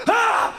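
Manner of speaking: very high, loud scream of shock; startled scream. Retold or imitated. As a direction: scream as if rammed from behind. Man recoils from contact.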